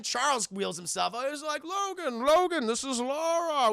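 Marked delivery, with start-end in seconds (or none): 1.6-3.7 high-pitched imitation of a mentor calling; lightly voiced, higher character voice; pleading old mentor. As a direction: make a raised-voice introduction, pleading. Charles introduces Laura to Logan.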